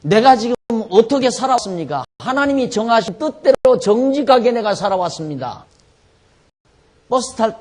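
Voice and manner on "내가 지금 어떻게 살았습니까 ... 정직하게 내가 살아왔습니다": higher-pitched, pleading prayer to God; 히스기야의 호소하는 기도. Acted. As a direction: plead your case before the Lord, voice raised in pitch. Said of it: "히스기야가 드린 기도". (높은 목소리로 간절히 호소하는 기도)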